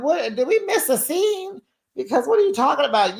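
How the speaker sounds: high-pitched, baffled questioning; incredulous. Acted. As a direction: demand an explanation in disbelief. Mock disbelief at the edit.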